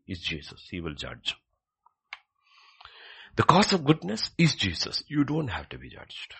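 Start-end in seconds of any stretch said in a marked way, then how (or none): 3.4-6.3 raised, loud preacher's voice; emphatic preaching. Real, not acted. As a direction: proclaim it high and forcefully. Preaches at full force.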